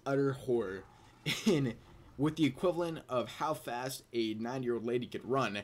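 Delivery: ordinary talk, nothing marked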